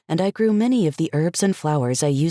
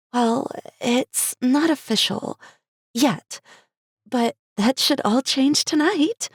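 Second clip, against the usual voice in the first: slow, high, hesitant; hesitate, then brighten; faltering; 10 semitones higher, slower than usual. Acted. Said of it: "Cherry's face faltered".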